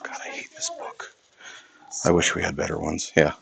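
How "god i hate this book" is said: unvoiced grumbling whisper; whispered aside. Real, not acted. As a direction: whisper it, annoyed, to yourself.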